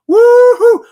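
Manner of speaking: very high, very loud woohoo; excited whoop. Real, not acted. Host cheering loudly as friends from the UK arrive. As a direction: whoop with delight.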